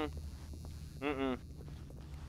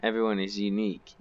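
ordinary delivery

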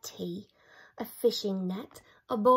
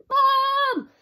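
much higher, louder yelp; holler; 8 dB louder than usual. Acted. Harold with burning pants, hollering. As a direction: yelp it in pain. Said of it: "Harold hollered".

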